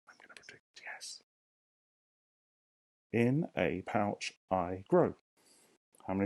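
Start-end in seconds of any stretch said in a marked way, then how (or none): 0.1-1.2 soft secret whisper. Real, not acted. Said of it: "teacher whispers her guess". 3.1-5.1 drawn out, syllable by syllable; slow syllable count. Shown as a demonstration. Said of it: "teacher counts syllables aloud".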